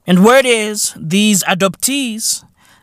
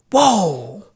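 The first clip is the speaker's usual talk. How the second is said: ordinary delivery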